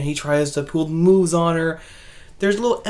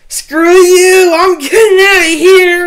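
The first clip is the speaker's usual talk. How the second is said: much higher, loud shouted bail-out; kid yelling; 12 semitones higher, 14 dB louder than usual. Retold or imitated. Little kid flees the house, high loud yell. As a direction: yell it like a panicked kid fleeing. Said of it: "this little kid's like".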